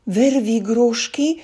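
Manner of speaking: high, slow, loud fearful blurt; terrified gasp. A narrator's reading. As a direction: choke out the name in terror. Elf sees giant, faints.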